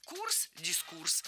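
Курс, дискурс said slowly, both stressed on КУРС. Shown as a demonstration. Teacher gives a memory aid, slow stress on КУРС. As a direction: let дискурс echo Курс with final stress. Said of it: "запомнить ударение в этом слове легко".

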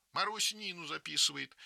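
plain reading voice

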